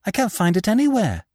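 high-pitched, dismayed report. A narrator's reading. Nephew runs back without the knife, dismayed. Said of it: "running back in dismay".

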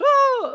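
high, loud mock "Whoa!" of a falling stormtrooper; imitated falling scream. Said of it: "He goes".

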